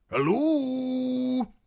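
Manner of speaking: high, hesitant call into the empty yard. A narrator's reading. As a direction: call out to see if anyone's there. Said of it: "called Nimble Dick".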